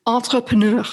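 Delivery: Entrepreneur said the French way, ahn-treh-preh-NEUR; French-accented Entrepreneur with a stressed final syllable. Shown as a demonstration. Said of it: "Now say it with a French accent too".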